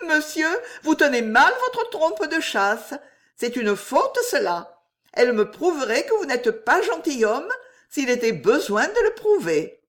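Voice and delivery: high-pitched, mocking young lady; impertinent, high and lilting; exquisite impertinence. A narrator's reading. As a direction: laughingly put him in his place. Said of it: "avec une moue d'exquise impertinence".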